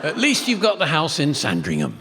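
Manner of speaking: ordinary talk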